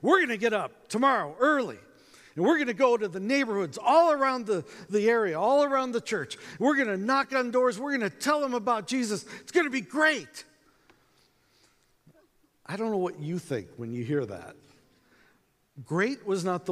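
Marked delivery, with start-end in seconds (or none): 0.0-10.4 high, loud rallying pep talk; hammed-up enthusiasm, building to a big finish; over-enthusiastic. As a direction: imitate an overeager team captain. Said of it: "a little too much enthusiasm".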